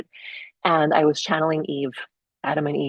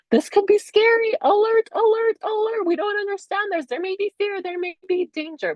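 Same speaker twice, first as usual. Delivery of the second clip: high-pitched panicky brain alarm; mock alarm. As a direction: sound the alert like an overanxious brain. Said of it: "our brain kinda goes".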